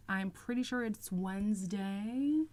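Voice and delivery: ordinary talk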